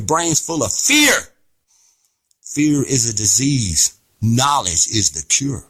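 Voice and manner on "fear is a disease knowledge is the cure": low, slow and measured; solemn close. Real, not acted. Closing slow, low statement.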